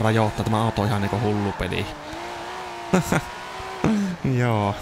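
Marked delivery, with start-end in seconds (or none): 1.9-4.3 breathy laugh. Real, not acted. Car behaving crazily.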